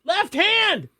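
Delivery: high-pitched insistent repeat; insistent answer. Real, not acted. Contestant presses the judge for the point, high-pitched insistence.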